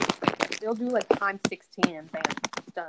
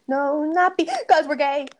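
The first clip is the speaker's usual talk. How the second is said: higher, louder, stressing because; louder, emphatic; 7 semitones higher, 7 dB louder, slower than usual. Pretend. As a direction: hit because hard and high.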